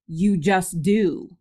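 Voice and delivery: very slow, no-nonsense answer; imitated hard-ass teacher. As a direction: spell it out slowly like a tough teacher. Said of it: "she's a hard ass, right?".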